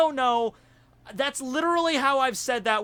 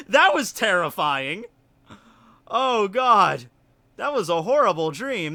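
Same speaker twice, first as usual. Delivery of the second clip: normal talking voice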